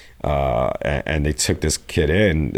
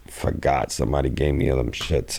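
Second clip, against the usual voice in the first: ordinary delivery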